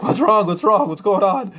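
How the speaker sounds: mock a wife startled awake; alarmed wife. Wife woken by freaked-out husband, high alarmed cries. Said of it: "my wife woke up and she's like".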